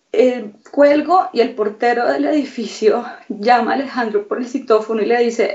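ordinary talk

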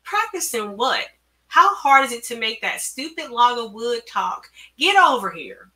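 scornful, barked order. A narrator's reading. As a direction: bark it impatiently at a worker. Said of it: "Bob barked".

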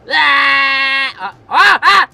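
high, drawn-out yell then short cries; loud scream. Pretend. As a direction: scream as if falling from a jump. Screaming during the jump.

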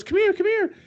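high mock nurse exclamation; imitating the nurse. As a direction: squeak it out like the panicking nurse. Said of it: "And then she goes".

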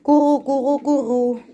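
high, loud pigeon coo. Acted. Speaker coos among the pigeons, high cooing.

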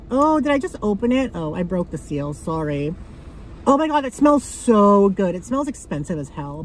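usual speaking voice

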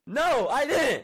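slow loud protesting no; angry denial. Real, not acted. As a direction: drag it out, indignant.

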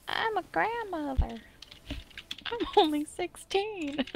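squeaky high mock girl voice. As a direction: pitch it way up, soft and coy.

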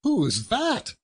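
high, astonished query; surprised question. A narrator's reading. Nephew asking who has arrived, surprised. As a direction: ask it high, taken aback. Fred startled by a visitor.

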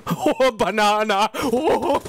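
exaggerated, slow cartoon cries; silly monkey-banana imitation. Player copying a banana clip for the final round, silly cartoon voice. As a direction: hoot it out in a put-on voice.

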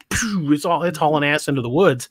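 usual speaking voice

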